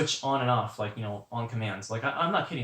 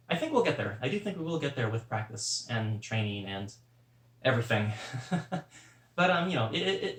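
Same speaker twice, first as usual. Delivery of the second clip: ordinary talk, nothing marked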